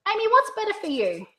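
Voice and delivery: high, lilting question to a client; lifted questioning pitch. Shown as a demonstration. Consultant asks Amy which suits her, lifted questioning pitch. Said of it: "listen to the tone of pitch in my voice".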